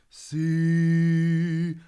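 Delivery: dropped larynx. Shown as a demonstration. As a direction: drop the larynx for depth on "See". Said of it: "letting that larynx drop down to give a bit of depth".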